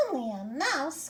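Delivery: high, squeaky mouse voice, offended; a tiny queen insulted, mildly huffy; indignant. Acted. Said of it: "cried the little animal indignantly".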